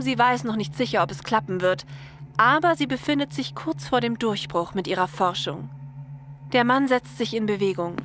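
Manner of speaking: usual speaking voice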